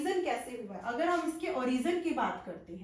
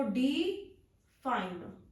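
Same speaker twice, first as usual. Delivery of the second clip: Define split in two, डि, pause, fine; define in two slow, separate pieces; broken into syllables; much slower than usual. Shown as a demonstration. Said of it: "इसे हम break करें".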